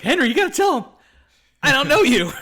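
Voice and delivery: loud, high desperate plea; mock panic. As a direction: call out frantically for someone to vouch for you. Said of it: "the look of panic".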